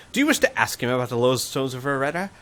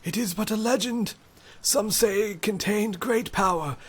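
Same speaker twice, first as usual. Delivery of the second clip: put-on high, cautious old voice; wary old man, guarded; shaky old voice; 7 semitones higher than usual. Magician tells the legend.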